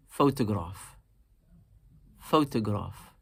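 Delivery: "photograph" with the first syllable stressed, then falling. Shown as a demonstration. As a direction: say "photograph" leaning lightly on PHO. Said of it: "we slightly emphasize the first one".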